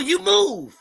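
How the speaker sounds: normal talking voice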